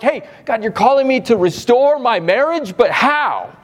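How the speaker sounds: high, anxious plea to God; a struggling spouse asking God how to save the marriage; pleading prayer. Acted. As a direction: ask God how, near despair. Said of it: "where you're like".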